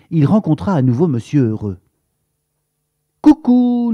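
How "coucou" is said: high, loud, beaming hello; bright sing-song coucou from a smiling neighbour; cheerful greeting. A narrator's reading. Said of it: "avec un large sourire".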